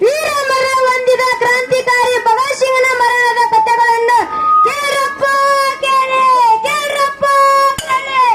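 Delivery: high, loud sing-song calling out; town crier. Acted. Crier calling villagers to hear Bhagat Singh's story, loud sing-song. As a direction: call the whole village to come and listen.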